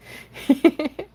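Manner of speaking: high giggling laugh. Real, not acted. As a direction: giggle at her own joke. Laughs at own mischief joke.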